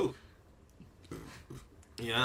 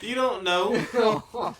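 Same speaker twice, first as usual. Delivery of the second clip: slow teasing; 11 semitones higher, 5 dB louder, much slower than usual. Acted. Teasing drawn-out reply.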